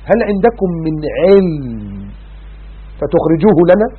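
slow, drawn-out melodic recitation; Quranic recitation. Acted. Preacher reciting the verse to the congregation, slow melodic chant. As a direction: chant the verse slowly, stretching each word.

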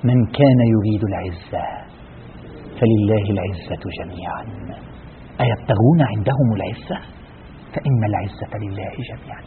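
slow, low, soft verse recitation; hushed recitation. Real, not acted. Preacher reciting verses after exposing false honour, hushed recitation. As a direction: recite it slowly, sinking almost to a hush.

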